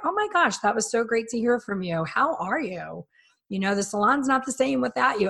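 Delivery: high, effusive mock client voice; imitated gushing reply. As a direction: play an over-delighted caller.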